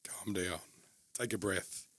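soft, steady inner voice of a security professional; calming self-talk. Acted. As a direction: talk yourself down softly. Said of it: "the security mind's going".

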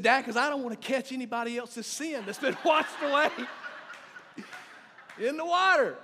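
dad laughing while imitating his son. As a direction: giggle through it as the boy. Father voices son, laughing.

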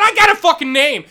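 indignant yell. Retold or imitated. Janitor insisting on his name, indignant yell. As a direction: demand respect, yelling. Retold shouting match with principal.

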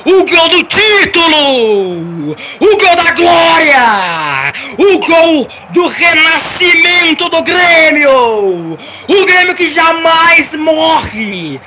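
slow, drawn-out triumphant shouting; goal celebration call. Acted. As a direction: stretch every word like a title-winning goal.

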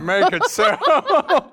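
slow, high and laughing through it; drawn-out Picard command. Retold or imitated. Friends bantering about Picard, a slow laughing mock command. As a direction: stretch the order out until it cracks into a laugh.

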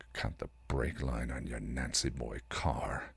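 muttered low and slow; low growl. Acted. Spike glaring at Ben, a low slow growl. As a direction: growl it under the breath. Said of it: "Growling, frustrated".

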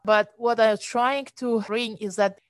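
ordinary talk

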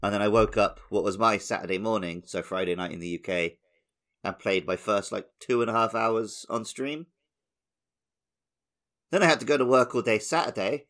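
ordinary delivery

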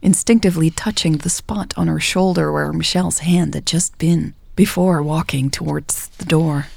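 usual speaking voice

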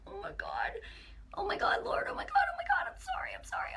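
high, breathless whispered apology; whispered oh my God, I'm sorry, high and hushed; frantic whisper. Retold or imitated. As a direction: gasp it out in a panicked whisper. Horrified at her mistake before God.

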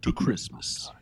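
hushed, breath-led spirit welcome; mysterious disembodied voice. Acted. Game master voices the star welcoming players, hushed and mysterious. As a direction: softly intone it like an unseen presence. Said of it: "you all hear a voice that says".

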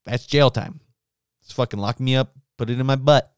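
ordinary delivery

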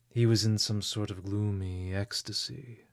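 plain reading voice

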